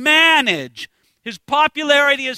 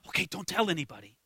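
softly, much quieter, a little quick; low voice; 8 semitones lower, 13 dB quieter, faster than usual. Retold or imitated. Jesus quietly tells the disciples to keep it secret, in a low voice. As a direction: hush it like a private aside. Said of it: "says in a low voice".

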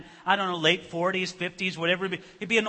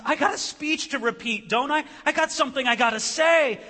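high, loud, urgent blurting; tearful bluster, acted; 7 semitones higher, 6 dB louder than usual. Son insisting on giving his speech, acted tearful bluster. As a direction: blurt it out, lightly mimicking tears. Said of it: "through his tears, through his bluster".

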